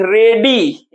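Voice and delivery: "रेडी" slowly, in two drawn-out syllables; syllable breakdown. Shown as a demonstration. Teacher finding the stress for students, "रेडी" slow and split. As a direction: stretch both syllables of "रेडी". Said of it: "stress किस पर लगा है".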